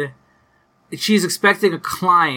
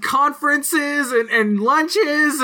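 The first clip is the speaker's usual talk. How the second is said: squeaky indignant rant, pitched way up; high-pitched outrage; 9 semitones higher than usual. Retold or imitated. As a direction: pitch it up in disbelief. Voicing Rebecca's outraged rant.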